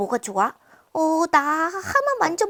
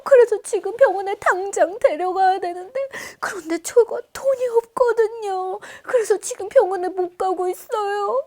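tearful pleading; 4 semitones higher than usual. Acted. Mother pleads, sick son.

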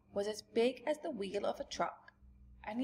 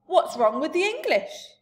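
indignant, much higher and louder; 8 semitones higher, 12 dB louder than usual. A narrator's reading. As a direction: demand it, offended. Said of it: "quite angry".

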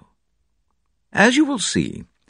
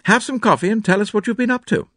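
jovial man voice; 12 semitones higher than usual. A narrator's reading. John hospitable.

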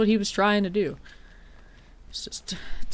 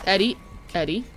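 ordinary talk, nothing marked

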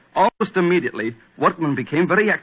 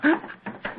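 gasp; 5 dB quieter than usual. Acted. Heart seizes mid-sentence.

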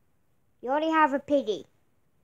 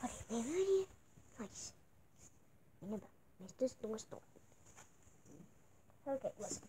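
much softer, under the breath; muttered aside; 5 semitones lower, 20 dB quieter, faster than usual. Acted. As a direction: mutter to yourself.

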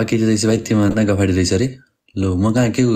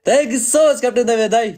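much higher, rising thank-you; gushing thanks; 11 semitones higher than usual. Real, not acted. Streamer thanking Captain dai a second time, gushing. Thanking the same donor again.

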